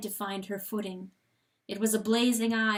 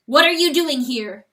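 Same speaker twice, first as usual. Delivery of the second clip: much louder, higher angry demand; 5 semitones higher, 11 dB louder than usual. A narrator's reading. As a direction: drive the question like a nail. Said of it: "he demanded".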